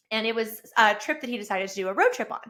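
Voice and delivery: normal talking voice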